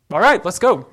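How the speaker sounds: loud, brisk all right let's go; everybody jumps up at once, loud and decisive; group agreeing. Retold or imitated. The room agreeing to leave, loud and quick.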